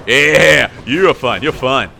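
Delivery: loud, emphatic reassurance. Real, not acted. Reassuring loudly mid-fight.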